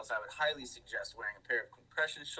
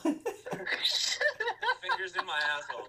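laughing; 7 semitones higher than usual. Real, not acted. Joking, cracking up while listing.

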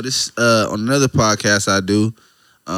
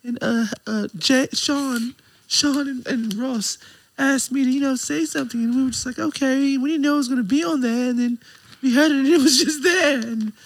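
lightly voiced higher woman's voice; mimicking a woman; 13 semitones higher than usual. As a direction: imitate her telling the story. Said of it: "She said".

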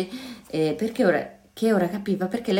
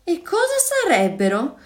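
scared question; 9 semitones higher than usual. A narrator's reading. Frightened question before the attack.

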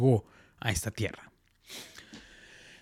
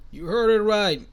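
higher, louder promo announcer; 8 semitones higher, 4 dB louder, slower than usual. Pretend. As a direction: hype it up like a radio ad. Anuncio con voz de locutor. (voz de anuncio de radio, emocionada)

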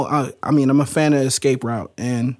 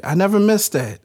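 ordinary talk

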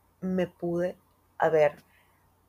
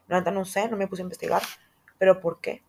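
ordinary talk

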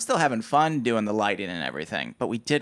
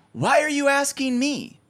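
pitched well higher and louder, indignant demand; snippy actor; 11 semitones higher, 4 dB louder than usual. Retold or imitated. The gaffer mimics the diva actor demanding he set the eye line, indignant and high. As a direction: play the entitled actor throwing the job back.

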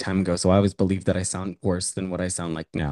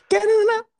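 shrieking high diva voice; squealed diva cheer; 6 dB louder than usual. Acted.

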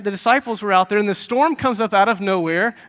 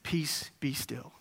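very slow whisper; calm a storm with a slow, whispered order; barely audible, unhurried stilling; hushed command; 4 semitones lower, 12 dB quieter, much slower than usual. Acted. Jesus calming the wind and waves.

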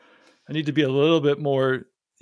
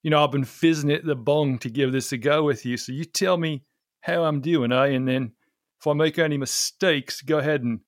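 put-on Aussie accent; Australian accent. Shown as a demonstration. Podcast guest testing an Aussie accent on an Australian, put-on Australian accent. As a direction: imitate a broad Australian accent. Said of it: "I can do an Australian accent".

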